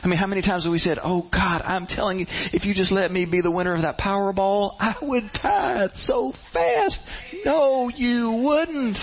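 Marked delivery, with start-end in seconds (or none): 1.0-7.0 high, pleading mock prayer building to a shout; hammed-up bargaining with God, rising to a yell. 7.4-9.0 high, drawn-out teasing no, slow; mock-scolding no. Real, not acted. Preacher rebukes the excuse.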